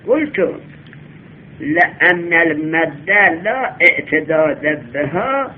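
slow, chanted reading of the Arabic line; drawn-out classical recitation; reciting the Arabic text. Shown as a demonstration. Reads out the Arabic source text.

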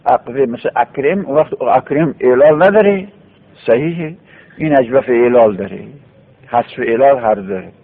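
ordinary delivery

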